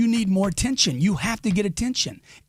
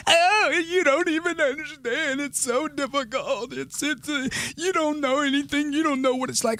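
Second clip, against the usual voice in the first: high, whiny tearful imitation; exaggerated high-pitched sobbing protest; mock crying wife; 9 semitones higher than usual. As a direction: wail back in a put-on weepy voice. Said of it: "she was crying".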